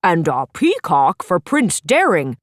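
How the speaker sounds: measured, booming bear professor; Professor Papa Bear. Acted. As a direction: present each pet slowly like a kindly teacher bear. Professor Papa Bear announces pets.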